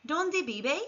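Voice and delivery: "Dónde vive" rising on dónde, v as b; model Spanish question. Shown as a demonstration. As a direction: say "Dónde vive" clearly for learners. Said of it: "Repeat after me".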